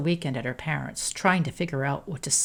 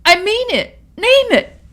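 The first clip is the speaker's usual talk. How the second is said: very high, loud frantic plea; higher and louder, frantic; desperate begging; 11 dB louder than usual. A narrator's reading. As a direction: beg her to name any price. Amanda keeps begging.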